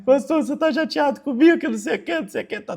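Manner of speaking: high, whiny fake-sobbing plea; mock crying. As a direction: whimper it like a hurt follower. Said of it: "Aí ele começa a chorar". (choramingo de brincadeira, bem agudo)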